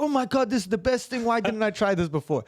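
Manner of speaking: high-pitched excited gushing, imitated; loving it. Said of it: "he's loving it".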